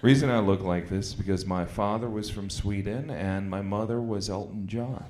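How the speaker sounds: usual speaking voice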